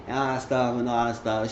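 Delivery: ordinary delivery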